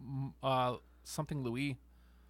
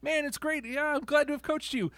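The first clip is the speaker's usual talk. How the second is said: high proud coach voice; hammed-up proud coach; mock Al Snow; 11 semitones higher than usual. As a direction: beam about your students. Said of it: "Al Snow comes out".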